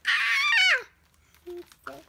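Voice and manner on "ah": sudden, very high, loud shriek; a startled snake lets out a piercing cry; snake's shriek. A narrator's reading. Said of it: "Bob the snake say".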